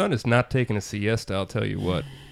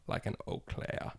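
unvoiced whisper; whispered pun; 12 dB quieter than usual. Host whispers a pun on Claire's name.

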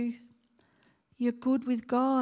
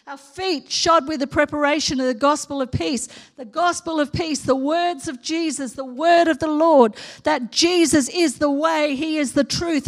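rising preaching, building in force; 2 semitones higher, 5 dB louder than usual. Acted.

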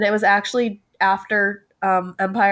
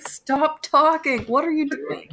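exasperated self-hush; 6 semitones higher, slower than usual. Retold or imitated. Inner voice scolding brother.

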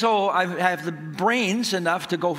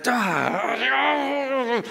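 long, high mock wail of pain; beside himself; 7 semitones higher than usual. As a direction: howl helplessly, re-enacting the agony. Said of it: "I'm just beside myself".

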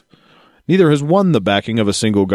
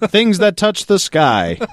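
high, slow, wide-eyed; a dreamy, pitched-up imitation of Palin; slow, gushing Sarah Palin parody; mock Palin quote; 8 semitones higher, slower than usual. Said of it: "she said".

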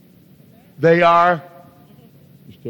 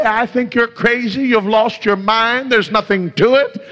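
high-pitched imitation of a critic; raised, dismissive mock voice; mocking scoffer; 7 semitones higher than usual. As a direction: mimic someone scoffing, pitched well up. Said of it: "and they may mock you".

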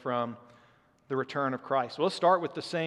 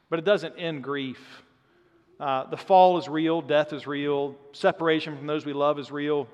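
ordinary talk

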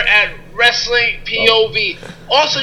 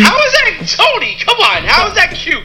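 incredulous; 7 semitones higher than usual. Real, not acted. Disputing "how cute" remark.

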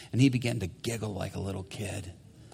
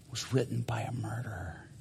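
whisper; 6 dB quieter than usual. Acted. Preacher dramatic reveal, hushed.

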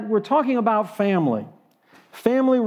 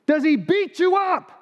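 much higher, disbelieving lawyer's question; incredulous attorney; 9 semitones higher than usual. Retold or imitated. Attorney asks his confused client, high and disbelieving. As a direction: ask it like you can't believe this conversation.

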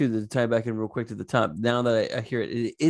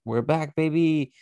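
higher, mock-triumphant cheer; retold elation; 5 semitones higher than usual. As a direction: play back the earlier optimism. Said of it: "I was like".

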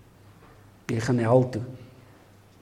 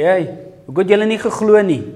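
sharp, fast rebuke, pitched higher and louder; rebuking; 6 semitones higher, 6 dB louder, faster than usual. Acted. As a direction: scold the disciples like Jesus. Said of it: "Jesus bestraf hulle".

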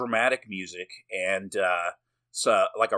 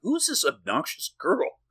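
mock puzzlement; 7 semitones higher than usual. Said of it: "I was like".

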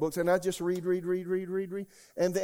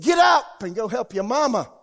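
high, loud urgent shout; the inner voice telling him to help his mother, shouted; shouted command; 6 semitones higher, 12 dB louder than usual. Retold or imitated. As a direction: bellow it as a heavenly order. Repeating the Spirit's command.

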